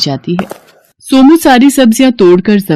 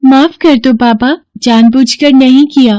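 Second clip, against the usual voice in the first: put-on a higher, childlike plea for forgiveness; pleading child; 6 semitones higher than usual. Children beg the angry cart owner for forgiveness, pleading. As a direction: beg like a sorry little kid.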